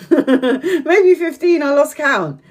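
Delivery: high, drawn-out words through laughter; laughing. Real, not acted. Admitting she lost count of years single, laughing.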